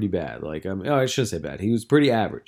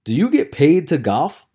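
pointed challenge; 5 semitones higher, 6 dB louder than usual. Retold or imitated. Retold challenge, first asking.

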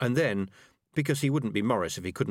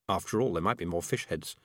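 plain reading voice

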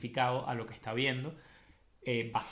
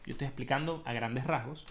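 ordinary delivery

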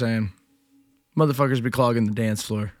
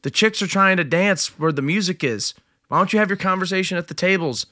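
higher-pitched exasperated complaint; raised pitch, pushing the point; retold telling-off; 7 semitones higher than usual. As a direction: make an indignant case to a guy in the way. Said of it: "I go".

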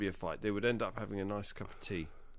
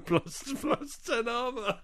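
laughing; 12 semitones higher, 5 dB louder, much slower than usual. Real, not acted. Laughing repeat of a joke.

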